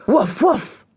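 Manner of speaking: high, loud shouted woof; loud barking shout. A narrator's reading. Sister imitating her brother at the door, loud barking shout. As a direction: bark the password hard. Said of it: "a voice shouted it loudly".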